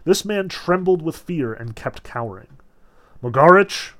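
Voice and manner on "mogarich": calling out. A narrator's reading. Azazello calls the fallen man.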